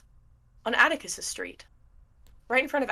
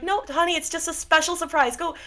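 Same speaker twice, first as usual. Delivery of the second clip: higher, sweet shushing mom; hurried mom voice; 8 semitones higher than usual. Acted. Mom shooing her son back outside to play. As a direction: soothe the kid while rushing him off. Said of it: "she's like".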